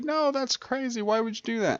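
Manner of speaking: high, disbelieving protest; incredulous viewer voice. Retold or imitated. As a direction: play the doubting viewer. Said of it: "you're gonna say".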